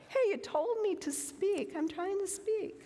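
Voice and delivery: high, put-on voice: "Hey, you told me to speak"; mock protesting voice. Speaker voicing herself answering God, mock protest. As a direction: pitch way up, playfully indignant. God's voice to her, joking.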